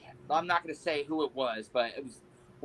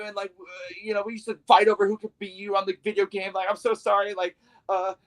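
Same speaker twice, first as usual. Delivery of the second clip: higher, pleading, rushed-out sorry; nervous groveling apology; 7 semitones higher than usual. Retold or imitated. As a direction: babble apologies up high like a terrified fan.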